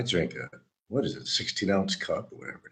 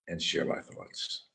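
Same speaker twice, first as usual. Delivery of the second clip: softer unvoiced echo of share my thoughts; whispered repeat; 7 dB quieter than usual. Shown as a demonstration. Repeating his phrase to viewers in a whisper. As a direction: breathe the echo as an aside.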